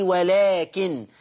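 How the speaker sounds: "ولكن" very slow, stopping on a light unstressed nun; pausing with light nun. Shown as a demonstration. Teacher showing callers how to stop on the word. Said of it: "ويقول لي".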